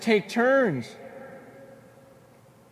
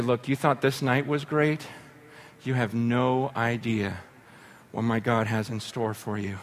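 hushed promise; 9 semitones lower, 8 dB quieter than usual. Real, not acted. Telling guests of God's plans.

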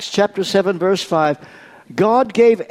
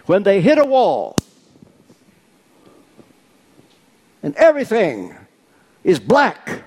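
preaching build; 4 semitones higher than usual. Acted. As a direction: build up toward God. Rising preaching climax.

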